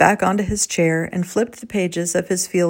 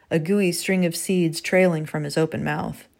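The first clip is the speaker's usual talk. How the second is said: ordinary delivery, nothing marked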